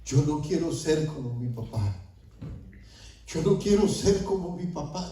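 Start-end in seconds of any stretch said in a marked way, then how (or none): 3.3-5.1 higher-pitched, imploring repeat; pleading prayer. Retold or imitated. Repentant father pleads with the Lord, higher and imploring. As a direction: raise the pitch into a retold plea to God. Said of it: "Y yo le empecé a decir al Señor".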